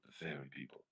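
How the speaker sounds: ordinary delivery, nothing marked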